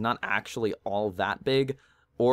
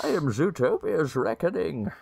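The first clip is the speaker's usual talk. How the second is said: dark lord; 6 semitones higher than usual. Pretend. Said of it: "There we go".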